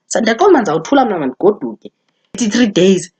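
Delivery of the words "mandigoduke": ordinary talk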